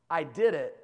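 normal talking voice

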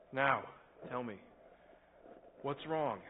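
soft, low, caring question; gentle coaxing. Acted. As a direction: coax him gently to open up. Pastor gently coaxing boy.

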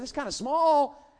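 "It's kinda small" said high, loud and slow, mocking; milk the disappointment for laughs; mock disappointed pastor. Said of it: "I said".

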